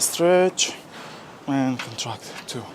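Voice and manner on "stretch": loud push. Real, not acted. Coaching himself through a stretch.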